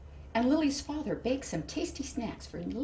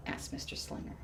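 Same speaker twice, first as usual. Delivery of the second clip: usual speaking voice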